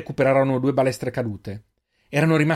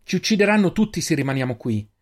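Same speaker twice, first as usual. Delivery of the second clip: usual speaking voice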